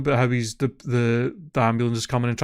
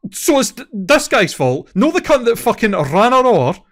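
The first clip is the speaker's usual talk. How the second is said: higher, louder, indignant rant; exasperated outrage; 6 semitones higher, 6 dB louder than usual. Real, not acted. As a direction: argue back in real disbelief at the commenters. Outraged at insane Reddit comments.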